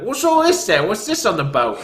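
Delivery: high, loud Cockney bobby voice; mock Cockney guard. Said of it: "in my best Cockney accent".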